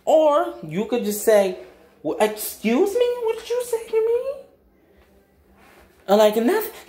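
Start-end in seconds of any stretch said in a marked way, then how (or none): 2.0-4.4 mock-offended, pitch climbing very high; indignant high-pitched disbelief; offended comeback. Shown as a demonstration. 6.4-6.9 high mocking imitation; put-on high little voice; backing-down bully.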